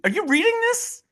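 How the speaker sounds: high-pitched mock disbelief; exasperated retort. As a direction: explain the obvious to a critic, voice jumping high. Acted retort to a critical follower.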